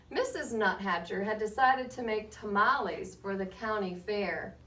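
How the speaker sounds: put-on lively read-aloud to children, rising on names; story narration. A narrator's reading. Reading to the class with lively storybook narration. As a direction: tell it like a picture book to a class. Reads picture book aloud.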